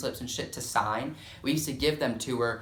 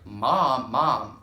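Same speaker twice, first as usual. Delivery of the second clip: raised call for Mom's attention; 5 dB louder than usual. Retold or imitated. Kid calling an unresponsive mom, calling out. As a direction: call Mom at normal pitch, a bit louder. First calls to mother, no reply.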